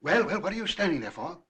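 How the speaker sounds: loud, impatient command; brusque summons. Acted. Judge hurrying his servant, brusque and impatient. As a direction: call out sharply to hurry a servant.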